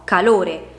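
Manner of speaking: calore with a strong LO, ca-LÒ-re; stress on middle syllable. Shown as a demonstration. Teacher concluding with correct calore, penultimate syllable stressed. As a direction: put the weight on LO in calore. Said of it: "Quella più intensa".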